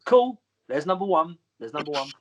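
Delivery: high, pained cool; feigned composure. Retold or imitated. Wrestler counting the first chop taken, feigning composure. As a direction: tight high voice, settling as you count.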